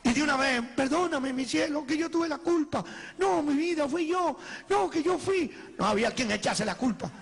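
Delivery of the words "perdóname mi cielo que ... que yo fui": high-pitched, lovey-dovey mock pleading; hammed-up begging, stretched out at the end; pleading apology. Sweethearts competing to take the blame, pleading apology.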